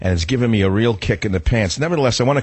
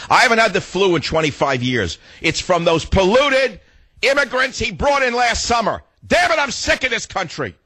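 high-pitched, indignant outburst; angry rant; 8 semitones higher than usual. Real, not acted. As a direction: rail at them, pitch jumping well up. Furious rant about illness and officials.

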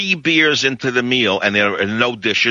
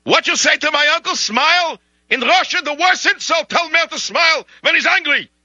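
very high, outraged mock Russian accent; angry Russian relative. Russian relative defends his insulted uncle, outraged high voice. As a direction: confront him as an indignant Russian, pitched way up. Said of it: "a relative was gonna come out and say".

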